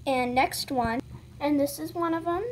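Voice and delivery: ordinary talk, nothing marked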